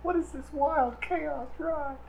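high panicked whine; freaked-out players. Retold or imitated. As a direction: squeal it in mock panic. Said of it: "looking back at me like".

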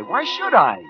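defensive outburst. Acted. Repeats it more forcefully.

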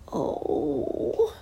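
put-on high whispered gasp of a boy; high breathy startled oh; frightened gasp. A narrator's reading. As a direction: catch your breath in fright. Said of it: "gasped the lost boy".

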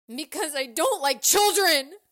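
angry outburst. A narrator's reading. Teacher blurting the truth to children, loud angry outburst. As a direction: blurt it out at full volume.

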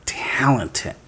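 hushed whisper, slow. Real, not acted. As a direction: whisper it like a guilty secret. Echoing partner in a whisper.

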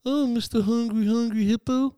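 very high-pitched playful coo; calling to a hippo. Pretend. Showing co-host how to address a hippo, mock-sweet. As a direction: greet the hippo in a silly high voice. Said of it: "go over there and talk to him".

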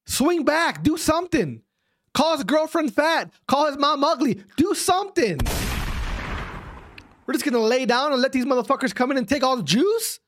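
high-pitched, exasperated goading; half-serious pissed rant. Real, not acted. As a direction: berate them into fighting back, half in jest. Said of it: "I'm pissed right now".

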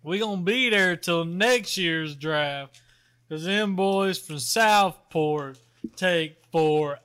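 slow, even, dragging delivery; slow monotone. Real, not acted. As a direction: draw every word out flat. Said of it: "if you could talk a little bit slower".

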